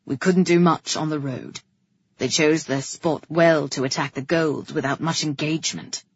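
low-voiced officer explaining; restrained brother. A narrator's reading. Brother explaining the attack to the king, restrained. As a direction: keep it low and measured.

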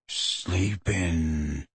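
low, soft, barely voiced; hushed giant near a sleeping beast; breathed. A narrator's reading. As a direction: breathe it so nothing wakes. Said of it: "breathed Hagrid".

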